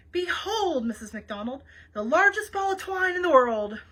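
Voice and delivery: loud, theatrical reveal; grand announcement. A narrator's reading. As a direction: proclaim it like a showman, voice lifted. Grand reveal of the twine ball.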